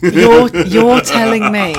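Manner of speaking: loud mock-outraged protest; incredulous outburst. Real, not acted. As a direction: call out the hypocrisy loudly. Incredulous at Guy's hypocrisy.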